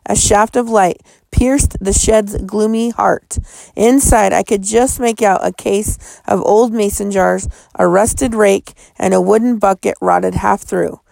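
plain reading voice

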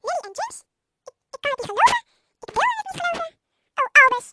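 loud, high cry of anguish; aghast, escalating outburst; shocked disbelief. A narrator's reading. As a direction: refuse to believe it, voice rising and bursting out. Professor gasps at news of deaths.

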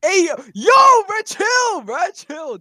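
high, loud bro yelling; frat bro shout. Real, not acted. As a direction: yell at him to chill out. Friend shouts to calm him.

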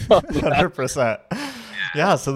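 slow agreement squeezed out through laughter; drawn-out laughing yes. Real, not acted. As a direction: agree while still cracking up. Laughing agreement after story.

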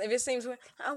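usual speaking voice

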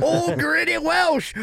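high, slow wrestling announcer call; hype announcer. Acted. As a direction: drag it out like a ring announcer. Host introducing co-host, hype.

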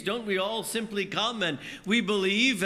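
ordinary talk, nothing marked

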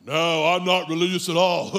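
low, put-on guy voice with a chuckle; goofy low imitation, laughing at the end; dude on the show.

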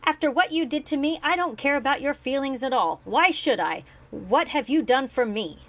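high, loud, bitter outburst; angry outburst. A narrator's reading. Julian to his father, loud and bitter. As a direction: let the old hurt explode at him.

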